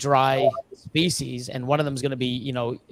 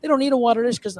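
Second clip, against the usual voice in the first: incredulous; 7 semitones higher, much faster than usual. Retold or imitated. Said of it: "I'm like".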